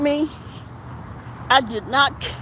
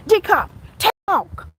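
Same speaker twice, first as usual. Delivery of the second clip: retold order; 6 semitones higher, 3 dB louder than usual. Lieutenant ordering him off.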